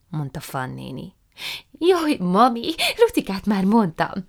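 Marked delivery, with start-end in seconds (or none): 1.8-4.2 put-on higher, lively young woman; Prue's eager voice. A narrator's reading. Prue apologising to her aunt, high and eager. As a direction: apologise sweetly and brightly.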